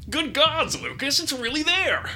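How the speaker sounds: high, loud squeaky little gnome; excited gnome voice. Acted. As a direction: blurt it out like an eager little knight.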